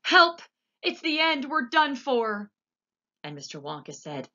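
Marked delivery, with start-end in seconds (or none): 0.0-2.5 very high, very loud cry for help; screaming in panic; panicked yell; terrified shout. A narrator's reading. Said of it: "And Grandpa Joe shouted".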